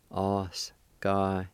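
Oskaya in separate, stretched syllables; slow syllable-by-syllable. Shown as a demonstration. Teacher showing the first part, slow syllable-by-syllable. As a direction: break Oskaya up and hold each part. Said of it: "pronounced like this".

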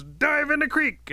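high, loud, drawn-out command; urgent shout. Acted. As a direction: yell an emergency order. Rabbit shouting orders in feigned emergency.

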